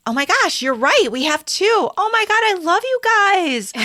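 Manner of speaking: high-pitched, gushing excitement; delighted surprise. Real, not acted. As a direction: squeal it out, thrilled at the find. Discovers a second listener review.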